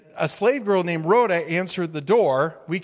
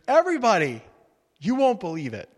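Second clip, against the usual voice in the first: excited announcement; 4 semitones higher than usual. Retold or imitated. Rhoda calling to the praying believers, excited. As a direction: burst in with the news. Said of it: "She goes".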